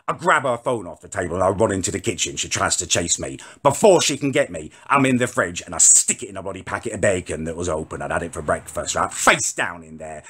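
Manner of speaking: ordinary talk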